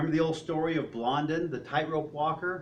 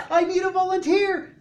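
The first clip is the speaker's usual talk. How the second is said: high, loud shout; showman's call; 12 semitones higher, 9 dB louder than usual. Acted. Tightrope walker calling for a volunteer, loud shout. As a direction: holler it out to the whole crowd. Acts Blondin calling to crowd.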